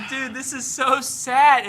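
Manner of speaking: high, slow, whiny self-pity; drawn-out mock lament over a lost league; mock sad whine. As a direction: ham up the heartbreak like a sulking fan. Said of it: "I'm like".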